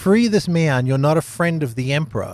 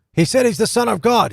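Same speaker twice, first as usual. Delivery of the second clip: high, louder burst of disbelief; alarmed realization; 9 semitones higher, 4 dB louder than usual. Acted. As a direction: blurt it out as it suddenly hits you. Acting out Pilate's dawning alarm.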